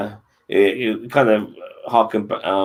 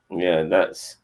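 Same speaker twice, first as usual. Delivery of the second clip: ordinary delivery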